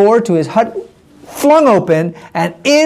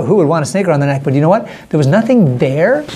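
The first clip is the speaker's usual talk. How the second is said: ordinary delivery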